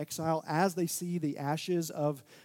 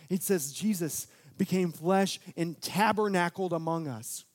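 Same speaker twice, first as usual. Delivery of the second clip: normal talking voice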